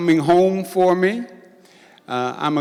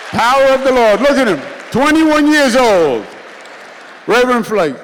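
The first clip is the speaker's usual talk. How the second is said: much higher and louder, teasing punchline; playful exclamation; 7 semitones higher, 7 dB louder than usual. Acted. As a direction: shout the joke out, delighted. Repeats the joke to the crowd.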